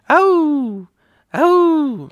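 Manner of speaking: high, drawn-out little howl; imitated baby howl. Dad imitating his newborn son, high howl.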